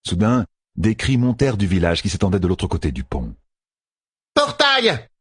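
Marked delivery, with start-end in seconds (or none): 4.3-5.1 very high, urgent call; urgent cry. A narrator's reading. Fail demanding a portal amid chaos, crying out. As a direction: cry out the order, pitched way up. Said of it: "cria Fail".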